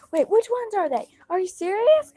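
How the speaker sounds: high-pitched retold freak-out. Friend suddenly freaking out at the storyteller, high incredulous questioning. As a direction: imitate a friend's rising, incredulous panic. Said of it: "she starts freaking out".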